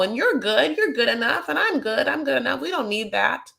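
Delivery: put-on Southern accent. Podcaster imitates Jenny refusing a workshop, mock-offended Southern accent. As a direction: put on a Southern voice, protesting she knows it all. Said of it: "I'm giving her Southern accent".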